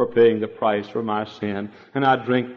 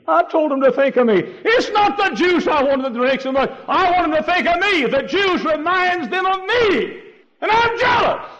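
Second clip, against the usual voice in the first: much higher and louder, building to a shout; jealous God; 4 dB louder than usual. Acted. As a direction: cry out as a wounded jealous God. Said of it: "God looks down and he says".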